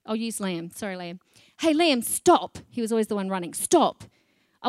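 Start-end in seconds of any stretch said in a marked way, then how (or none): 1.6-2.6 put-on raised call to a running kid; sharp mother's call, louder; calling out to stop. 3.5-4.0 put-on raised call to a running kid; sharp mother's call, louder; calling out to stop.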